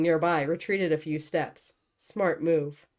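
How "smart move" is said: ordinary delivery, nothing marked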